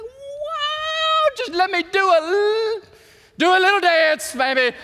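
high, loud, giddy squeal of joy; giddy excitement. Pretend. Thrilled at the news about the women, squealing high and loud. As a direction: burst out in a playful happy dance voice.

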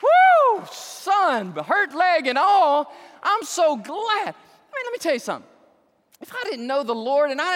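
loud, high, fervent preaching; full-force preaching. Acted. Preacher rejoicing over a healed man, loud fervent preaching. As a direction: preach it out to the pews.

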